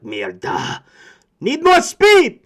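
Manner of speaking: put-on loud, high, slow shout in a Spanish accent; urgent captain shout. Martin shouting orders to his crew, loud Spanish accent. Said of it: "And Martin's like".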